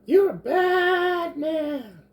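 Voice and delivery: very high, slow goblin yell; high goblin shout. Acted. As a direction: drag it out like a squeaky goblin. Goblin Rika taunts the king.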